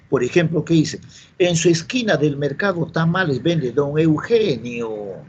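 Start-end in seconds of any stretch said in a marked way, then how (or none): 1.4-5.2 slow, drawn-out Amazonian charapa lilt; charapa accent. Acted. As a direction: imitate a charapa's sing-song Peruvian jungle Spanish, stretching the last word. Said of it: "el charapa le dice". (acento charapa cantado)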